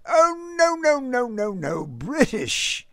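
put-on clipped English accent, starting high and sliding down; lofty British accent. A narrator's reading. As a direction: correct him politely in a British gent's accent. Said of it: "in his lofty, clipped accent".

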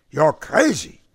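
put-on old uncle exclaiming at his nephew; mild cry. A narrator's reading. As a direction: snap it out in disbelief. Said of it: "Smalkovich cried".

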